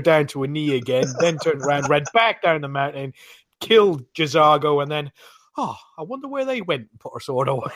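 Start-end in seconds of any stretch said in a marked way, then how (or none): 5.6-6.9 high, soft, faux-innocent musing; mock innocent. Gamer voicing his follower pretending innocence, mock-innocent and high. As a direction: play dumb in a higher, softer voice.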